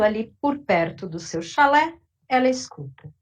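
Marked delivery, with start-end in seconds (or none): none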